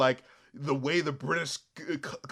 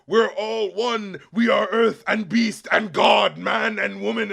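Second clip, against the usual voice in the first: slow, louder preacher-like proclamation; preaching villain; 4 semitones higher, 7 dB louder, slower than usual. Retold or imitated. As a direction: proclaim it like a sermon.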